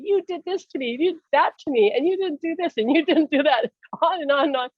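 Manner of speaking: high-pitched mock accusing tirade; retold angry ranting. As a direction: reel off grievances, acting out the fury. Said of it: "I was, like, so angry".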